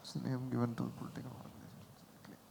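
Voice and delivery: whispered, no voice; whispering. Real, not acted. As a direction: whisper the clue to yourself. Player reads clue quietly.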